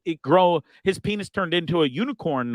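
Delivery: ordinary talk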